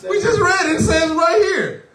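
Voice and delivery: high, loud and laughing. Real, not acted. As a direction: laugh while you point it out. Laughing at obvious answer.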